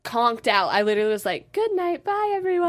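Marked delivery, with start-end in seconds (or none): 1.5-2.7 high, sing-song farewell; waving everyone off to bed; higher mock goodnight; mock sleepy goodbye. Retold exhausted goodbye.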